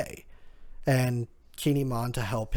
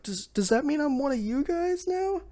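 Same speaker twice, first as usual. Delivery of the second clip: lightly voiced crying boy, much higher; hopeful little boy through tears, pitched way up; tearful kid; 13 semitones higher than usual. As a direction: squeak it out like a weepy little kid. Crying boy asks to join.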